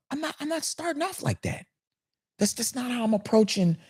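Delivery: high, soft put-on rude customer voice; a hint of a snooty orderer; mock rude customer. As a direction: sneer it like a snotty customer.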